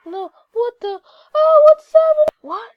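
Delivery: shrieking high in alarm; loud panicked what's happening; startled. Real, not acted. Sudden strange place, alarm.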